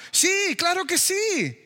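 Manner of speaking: drawn-out emphatic yes; slow, emphatic. Real, not acted. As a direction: stretch it slowly, high. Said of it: "answers himself, drawn out". (sí enfático, lento y agudo)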